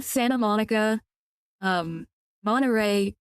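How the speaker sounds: "Santa" as SAN-uh, "Monterey" as MON-uh-ray; dropped T. Real, not acted. Streamer catching their own accent, "Santa" and "Monterey" without the T. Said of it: "I'm not pronouncing the T".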